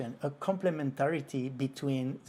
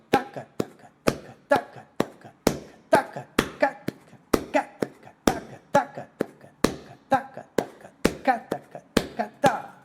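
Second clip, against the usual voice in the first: loud, high accented tacas; accented rhythm syllables; 10 semitones higher, 8 dB louder than usual. Shown as a demonstration. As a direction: punch the accents on taca. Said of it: "I can put the accent anywhere".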